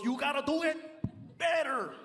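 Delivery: lightly voiced scolding comic; Carlos. Imitated comic criticizes the impression of him, mildly mad. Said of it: "he gets mad at me 'cause he goes".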